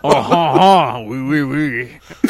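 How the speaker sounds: slow, drawn-out mock French accent. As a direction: ham up a French accent, slowly. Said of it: "We do a whole episode in French accents".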